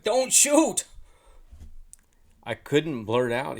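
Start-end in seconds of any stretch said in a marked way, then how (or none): 0.0-0.8 high, loud panicked-goose shout. A narrator's reading. Goose with wings up to the hunter, shouting. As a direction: shout it out, pitched way up. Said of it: "he held up his wings and he shouted".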